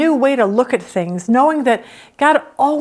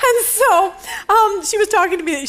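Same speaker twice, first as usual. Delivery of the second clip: talking through leftover laughter, higher; laughing; 8 semitones higher than usual. Real, not acted. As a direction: still giggling as she goes on. Still laughing after the joke.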